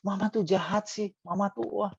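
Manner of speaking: put-on high, whiny child's complaint; child grumbling at mother, a touch hammed-up; sulky child. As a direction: play the pouting kid blaming mama. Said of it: "Terus dia marah".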